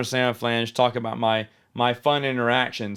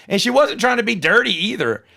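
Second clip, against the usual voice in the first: ordinary talk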